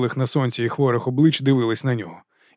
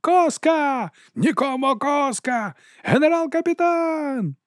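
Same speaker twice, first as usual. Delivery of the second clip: cheering crowd; much slower than usual. A narrator's reading. Soldiers acclaim their captain, drawn-out high cheer. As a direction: imitate a distant army hailing its leader. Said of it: "Прогримів схвальний рев".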